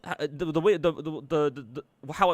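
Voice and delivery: tripping over the words; stammering, stuck on the; stuttering. Real, not acted. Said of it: "if I can stop stuttering like a fucking idiot".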